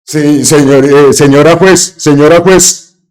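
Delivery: lightly voiced servile yes-sir to the judge; mocking Uribe. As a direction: mimic the senator's submissive reply to the judge. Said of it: "sale diciéndolo así".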